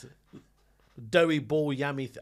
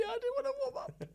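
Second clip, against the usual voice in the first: laughing meek reply; 6 dB quieter, faster than usual. Retold or imitated. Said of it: "I was like".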